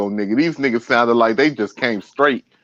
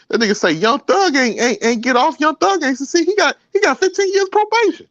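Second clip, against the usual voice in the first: high, fast put-on voice, climbing ever higher; hammed-up high-pitched podcaster impression; imitating six oh seven Unc. Retelling his podcast bit to a friend, high mocking voice.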